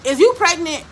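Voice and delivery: high, loud, pointed question; blunt question. Retold or imitated. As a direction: mimic a blunt stepmom demanding an answer. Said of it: "My stepmama was very blunt".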